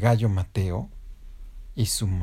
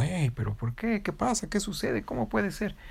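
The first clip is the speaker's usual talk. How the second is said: high-pitched puzzled questions; baffled teachers; 10 semitones higher than usual. Acted. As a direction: pile up the bewildered questions, pitched up. Baffled teachers voiced in story.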